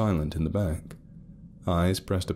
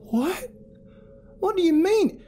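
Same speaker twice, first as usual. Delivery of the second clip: higher and louder, urgent disbelief; Carol in disbelief; 5 dB louder than usual. A narrator's reading. Carol pushing Ruth to tell them, urgent disbelief. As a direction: demand she tell them.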